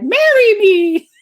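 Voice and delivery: high, loud, drawn-out cry; playfully hammed-up fan plea; mock proposal. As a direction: squeal it out slowly like a starstruck fan. Mock plea at a chased star.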